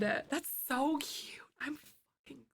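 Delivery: slow, breathless whisper; whispering over the cute gift. Real, not acted. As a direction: whisper it, overcome. Overwhelmed by the gift.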